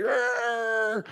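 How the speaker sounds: burning vampire growl; a mock pained roar. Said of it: "He's like".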